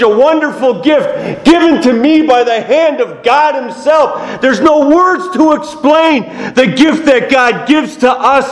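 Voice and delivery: high-pitched, exclaiming sermon; impassioned preaching. Real, not acted. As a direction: drive the message home to the congregation. Preaches at full force.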